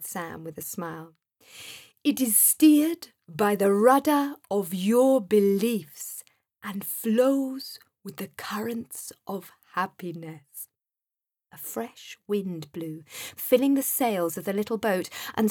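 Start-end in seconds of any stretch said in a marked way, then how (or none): 2.0-10.7 kindly Sam figure. A narrator's reading.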